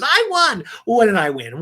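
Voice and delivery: high, loud victory yelp; mock triumph. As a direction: crow like a hammed-up winner. Retold boast after winning shot.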